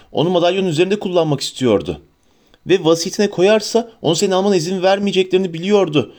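put-on excited young woman piecing it together; Hermione voiced, eager. A narrator's reading.

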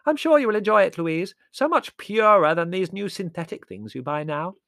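loud, high oblivious interjection; booming high aunt voice; hard-of-hearing aunt. A narrator's reading. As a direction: chime in loudly as if deaf. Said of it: "said Aunt Fan".

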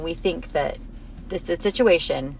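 ordinary talk

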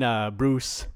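ordinary talk

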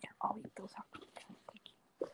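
breathy aside under breath; whispering. Real, not acted. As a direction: whisper it to yourself.

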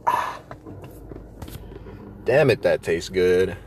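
ordinary talk

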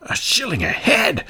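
high, breathless whispered gasp; shocked cry. A narrator's reading. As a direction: gasp at the price. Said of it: "cried the citizens".

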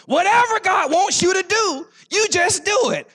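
loud and high, driving the point home; preaching shout. Real, not acted. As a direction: call it out to the congregation.

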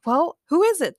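high, impatient demand. A narrator's reading. James interrupts Ray's ring rant, demanding. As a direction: cut in and demand an answer. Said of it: "James demanded, interrupting Ray's ring rant".

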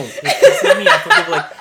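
high laughing outburst. Real, not acted. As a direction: burst out laughing mid-rant. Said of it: "Dramatic ass".

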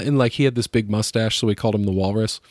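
ordinary talk, nothing marked

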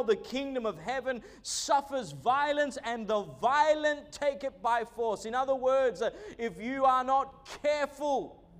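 rising, rolling sermon delivery; preaching. Acted. Pastor preaching to his church, fervent sermon voice. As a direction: preach it to the congregation with conviction.

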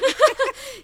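loud, high burst of laughter. Real, not acted. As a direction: break up laughing loudly. Laughing at failed pronunciation.

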